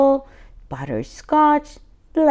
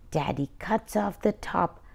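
ordinary delivery, nothing marked

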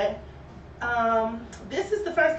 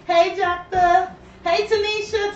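excited greeting; 6 semitones higher, 4 dB louder than usual. Real, not acted. Greeting viewers on live.

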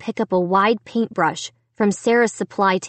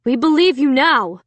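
dad declaring it firmly, pitch raised; emphatic declaration; 4 semitones higher, 4 dB louder than usual. A narrator's reading. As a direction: declare it with conviction. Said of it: "Dad declared".

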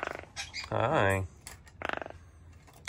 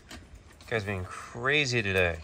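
usual speaking voice

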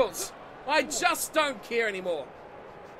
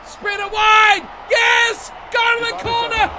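loud, higher urging of the players; excited shouting; 8 semitones higher, 9 dB louder than usual. Real, not acted. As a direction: roar them on toward the corner. Cheering a break toward the corner.

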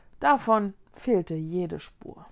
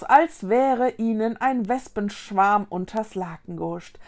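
usual speaking voice

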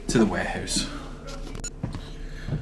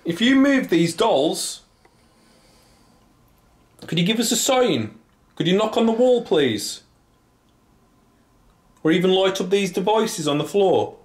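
high, carrying questions to unseen listeners; calling out to spirits; 8 semitones higher than usual. Real, not acted. Investigator asks spirits for a sign, calling out. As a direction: pitch it well up and ask the empty room.

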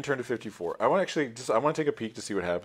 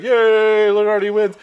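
high, loud, stretched-out cheer; mock celebratory yell; cheering crowd; 11 semitones higher, 13 dB louder, slower than usual. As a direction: cheer like a crowd at the finish line. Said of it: "there's a bunch of people cheering".

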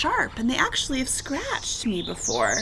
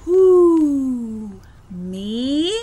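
long hooted first word, rising second; owl voice; 2 semitones higher, 5 dB louder than usual. A narrator's reading. Owl answering Hank's offered hug, hooting owl voice. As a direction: hoot it like a surprised owl.

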